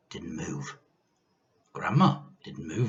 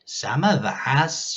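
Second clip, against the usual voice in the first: slow, louder false comfort; menacing grandma; 5 semitones higher, 6 dB louder, much slower than usual. A narrator's reading. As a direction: soothe with menace. Witch voice.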